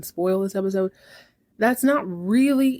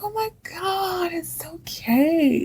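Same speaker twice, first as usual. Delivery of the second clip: slow, high, breathy reassurance; drawn-out airy coo, fading to a whisper; Maggie, spaced-out calm; 10 semitones higher, slower than usual. Retold or imitated. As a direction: imitate someone blissfully high soothing a friend. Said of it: "Maggie is always calm, way cooler".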